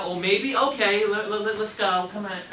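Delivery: loud, breezy mock shrug-off of a maybe; letting it go. Shown as a demonstration. Coach mocking an agent who drops the buyer's maybe: loud, careless. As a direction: brush the answer aside cheerfully. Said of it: "Don't just let it out".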